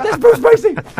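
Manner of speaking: high, loud squealing disbelief; starstruck outburst. Retold or imitated. Starstruck fan correcting his unimpressed girlfriend, squealing. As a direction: burst out at top pitch, overwhelmed. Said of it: "and I'm like".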